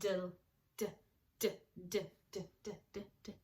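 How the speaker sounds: d sound repeated crisply; sounding out words. Shown as a demonstration. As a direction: tap out the d sound again and again.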